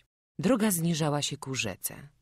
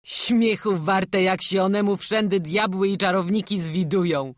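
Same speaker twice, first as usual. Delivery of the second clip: loud, higher exclamation; scornful calling out; 6 semitones higher, 8 dB louder than usual. A narrator's reading. Kostek the village unbeliever scoffs at talk of devils, calling out. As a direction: call it out loudly, pitched up. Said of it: "zawołał Kostek".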